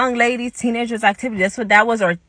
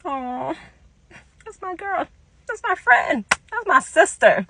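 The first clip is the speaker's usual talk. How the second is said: retold happy affection; 8 semitones higher than usual. Teller moved by caring older friend, happy affection. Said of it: "I was so happy".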